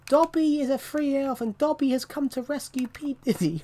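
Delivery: high squeaky elf voice; high house-elf voice retelling the clip; Dobby voice. Retold or imitated. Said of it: "someone did in an A I video".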